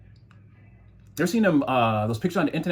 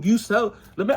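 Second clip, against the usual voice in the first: usual speaking voice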